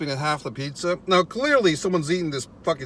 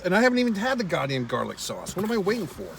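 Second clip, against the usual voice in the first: usual speaking voice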